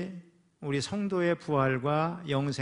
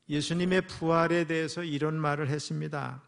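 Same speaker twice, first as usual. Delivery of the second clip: ordinary talk, nothing marked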